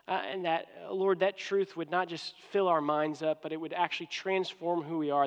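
soft, low public prayer; earnest prayer with the voice brought down. Real, not acted. Leads the congregation in prayer.

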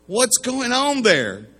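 put-on an alarmed dad demanding answers from a child; hammed-up rising alarm. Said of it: "Now he's really upset".

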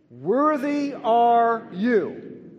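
loud, slow, high proclamation; congregation call. Shown as a demonstration. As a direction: proclaim it slowly and loudly together. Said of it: "let's say the first three words together".

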